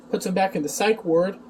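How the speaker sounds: ordinary delivery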